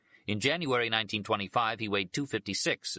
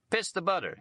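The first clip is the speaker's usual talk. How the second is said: put-on high childish "Piss the butter", much higher pitch; childishly; 12 semitones higher than usual. A narrator's reading. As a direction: say it like a bratty kid, way up high. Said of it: "he would say childishly".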